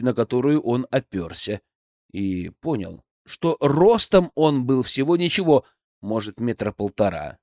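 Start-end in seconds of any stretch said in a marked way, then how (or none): none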